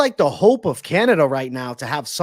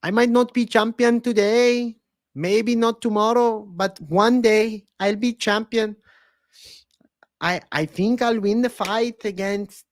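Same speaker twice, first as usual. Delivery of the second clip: put-on higher-pitched, slow, drawn-out accented fighter voice; Brandon Moreno impression; 7 semitones higher, slower than usual. Streamer obliging a fan with a fighter impression, high and accented. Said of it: "Brandon Moreno impression".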